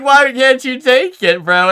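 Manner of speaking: high, loud, slow whine; a mock whimper from the guy who hates bugs; whiny coworker. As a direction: play the scared coworker begging. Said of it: "He was like".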